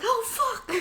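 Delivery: mock shocked outburst; startled curse. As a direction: blurt it out half-laughing. Jesse and James see Meowth glitched.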